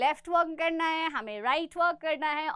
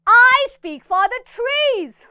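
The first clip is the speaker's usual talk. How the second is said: slow, loud, higher proclamation; loud proud declaration; 7 semitones higher, 8 dB louder, much slower than usual. Shown as a demonstration. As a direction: declare it to the whole room. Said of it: "जोर से बोलो". (ज़ोर से घोषणा)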